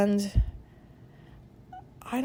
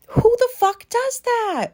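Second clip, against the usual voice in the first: much higher and louder, slowed, mortified; embarrassed outburst; 14 semitones higher, 5 dB louder, slower than usual. Real, not acted. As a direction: yelp it out, ashamed of yourself. Cringing at her own past remark.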